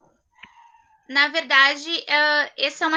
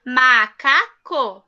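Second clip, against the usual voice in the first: slow repeat; 2 semitones lower, much slower than usual. Shown as a demonstration. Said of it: "teacher repeats word slowly".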